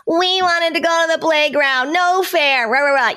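high, whiny kid complaining, mocking; whining child. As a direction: imitate a disappointed child whining. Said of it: "and they're like".

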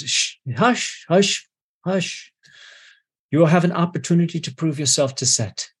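a hushing, faintly sinister priest; soothing priest. Acted. Zoyos hushes the crying priest.

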